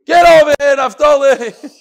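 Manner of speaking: a loud, high shout across the room; calling out loud. Real, not acted. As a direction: yell for the student to come up, for real. Teacher calls student to front.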